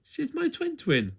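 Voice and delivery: high and slow, amused twin; high giggly twin. A narrator's reading. Twin explains her twin.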